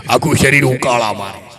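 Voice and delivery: put-on low, gruff old villager; old man complaining. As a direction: groan it out like an aged grandpa.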